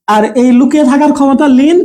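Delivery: ordinary talk, nothing marked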